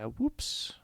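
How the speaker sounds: high-pitched comic little slip; mock sheepish oops. Acting caught-out reaction to news.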